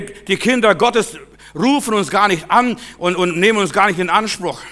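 loud, high sermon appeal; fervent loud preaching; full-force preaching. Acted. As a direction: preach it out with fire.

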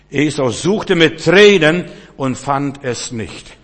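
loud, slow, weighty declamation; forceful preaching. Acted. Preacher warning the congregation about Esau, loud and slow. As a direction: let every word land heavy.

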